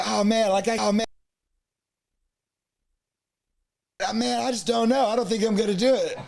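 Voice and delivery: hesitant laughing refusal. Retold or imitated. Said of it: "and I was like".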